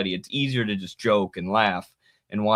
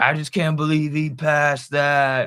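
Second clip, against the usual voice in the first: mock dismayed friend, higher and slower; stunned friend; 6 semitones higher, 4 dB louder, slower than usual. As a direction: play the drunk friend as thrown and disbelieving. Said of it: "He's like".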